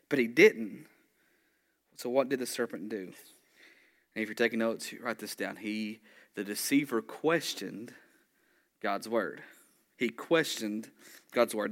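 usual speaking voice

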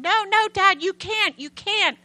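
high, loud panicked begging; desperate pleading. Retold or imitated. Daughter begging her father not to destroy it, high panicked shouting. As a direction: cry out to stop him, begging.

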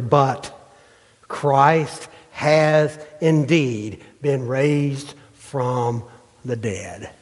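slow, drawn-out reading of the verse; slow scripture reading. Shown as a demonstration. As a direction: read each word slowly, weighing it. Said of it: "Look what he says, verse twenty".

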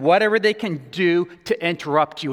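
ordinary delivery

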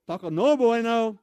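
slow loud taco no bueno, stress on no; mock-serious verdict on bad tacos; drawn-out taco no bueno. Shown as a demonstration. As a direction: lean hard on the no. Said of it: "That's my Spanish".

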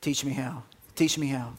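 quiet, lingering on "Teach me how"; repeated softly. Shown as a demonstration. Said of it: "A twelve-year-old wants to know".

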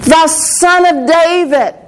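insistent mock cry over a crowd; Bartimaeus crying out. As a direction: stretch each word as a retold cry. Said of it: "began to cry out more and more".

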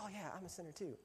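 breezy, hushed imitation of a casual confession; offhand mock admission. Voices people glossing over the admission.

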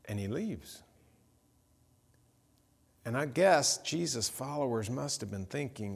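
usual speaking voice

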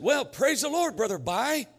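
lightly acted high-pitched greeting; dad's phone reply. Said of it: "My dad said".

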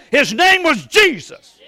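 loud, high shouting preacher; full-force preaching. Real, not acted. Preacher proclaiming Jesus and Paul, in a loud shouting preach. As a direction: proclaim it with fire.

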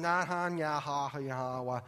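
lightly mocked kids' babble; inattentive kids. Preacher imitating kids ignoring a parent, mock inattentive babble. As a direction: imitate kids half-answering in nonsense syllables. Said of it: "you knew they weren't listening to you".

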